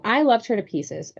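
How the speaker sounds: ordinary delivery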